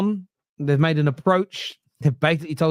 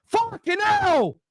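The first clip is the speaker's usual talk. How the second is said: very slow, much higher exasperated groan; much higher, drawn-out curse; rattled; 12 semitones higher, much slower than usual. Real, not acted. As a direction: drag it out in real frustration. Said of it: "And yes, I'm rattled".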